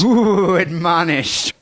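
rising ghostly "ooh", then a hushed verdict; mock spooky. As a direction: pretend the word is terrifying.

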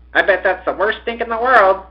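put-on high-pitched boastful boy; bragging. A narrator's reading. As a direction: brag like a kid to his gang. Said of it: "bragged another boy".

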